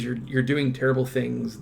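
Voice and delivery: usual speaking voice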